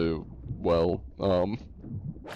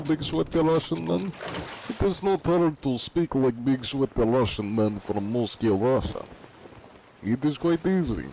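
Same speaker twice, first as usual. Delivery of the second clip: higher-pitched Russian accent; 4 semitones higher than usual. Shown as a demonstration. Gamer shows viewers his Russian, thick Russian accent. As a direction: speak like a Big Sweaty Russian. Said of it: "I can do a Russian accent".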